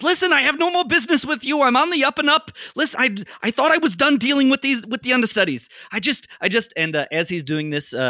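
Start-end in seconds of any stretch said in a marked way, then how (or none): 0.0-6.7 higher, fast nervous small-time crook. Acted. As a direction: babble your innocence quickly, a bit higher. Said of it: "Mo goes".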